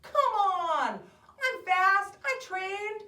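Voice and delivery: high, loud wail of protest; dismayed, high-pitched complaint; upset sore loser. Acted. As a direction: whine at losing the race. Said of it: "Henry is so sad".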